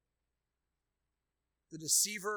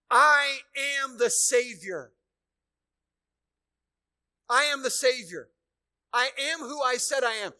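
Jesus proclaiming; 8 semitones higher, 15 dB louder than usual. Pretend. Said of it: "he says to everybody here".

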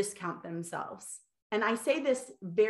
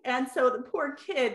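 ordinary talk, nothing marked

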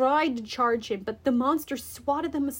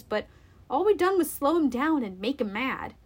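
ordinary delivery, nothing marked

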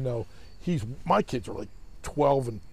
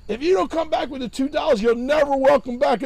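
high, raised-voice warning; retold shouted ultimatum, pitched up; yelled threat; 9 semitones higher, 6 dB louder than usual. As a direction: call out a threat to someone far across a yard. Threat called across forty yards.

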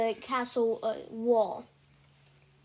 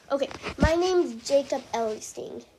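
usual speaking voice

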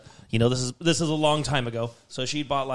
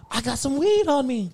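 high ditzy voice; 10 semitones higher than usual. Retold or imitated. Voicing Christine.

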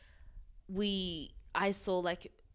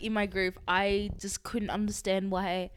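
normal talking voice

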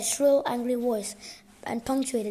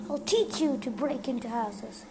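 ordinary delivery

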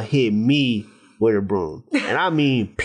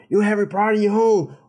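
higher, fast, put-on cook mourning his door; indignant cook; 6 semitones higher than usual. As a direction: whine about the door like the cook. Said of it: "he just goes on about the door".

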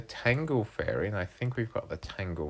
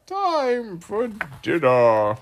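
high, loud, drawn-out spider voice; eager squeaky little spider; spider character; 11 semitones higher, 9 dB louder, slower than usual. Acted. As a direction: sing out each word slowly, up high. Spider character announces dinner.

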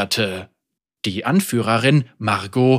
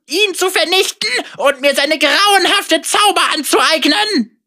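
hoch und gierig begeistert; gieriger Bösewicht; 14 semitones higher, 4 dB louder than usual. Acted. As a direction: vor Machtgier schwelgen. Vega gloats. (gierige Schurkenstimme)

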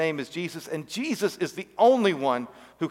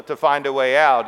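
ordinary talk, nothing marked

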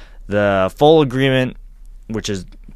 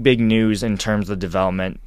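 ordinary talk, nothing marked

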